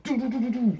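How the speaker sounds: high, rapid pew-pew blaster noises with the voice; imitated blaster fire. Mimicking the stormtroopers' blasters in the Ewok battle, high sound effects. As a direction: sound out the Ewok plasma guns firing. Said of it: "they're blasters".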